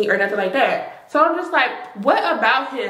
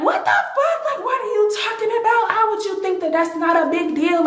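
very high, laughing outburst of disbelief; retold yelling; 11 semitones higher than usual. Yelling at the friend who shrugged it off, high laughing outburst. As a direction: shriek it in shocked, laughing outrage. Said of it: "Like, we yelling at her".

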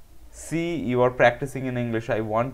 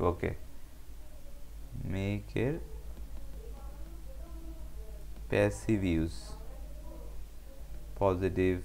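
halting, with long pauses, getting much softer; murmured note-taking; 6 dB quieter than usual. Real, not acted. Teacher noting a viewer's video request, saying it haltingly as written. আমি লিখে রাখছি.

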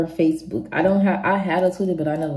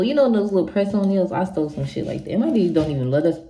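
ordinary delivery

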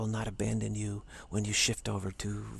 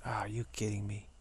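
normal talking voice

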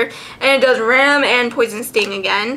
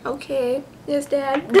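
meek reply; 8 dB quieter than usual. Retold or imitated. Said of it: "and I'm like".

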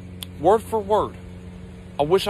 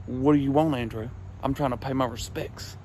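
low, soft imitated uncle; quiet lowered voice for a shady offer; hushed uncle at casket; 6 semitones lower, 10 dB quieter than usual. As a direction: keep it down, low and furtive. Uncle's hushed words beside the casket.

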